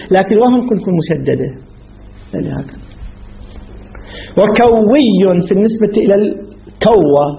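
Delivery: usual speaking voice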